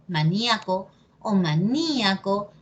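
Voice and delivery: ma-NIA-co, then ma-NÍ-a-co; first maníaco on A, second on Í; two accepted stresses. Shown as a demonstration. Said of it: "depende de ti cómo lo quieras decir".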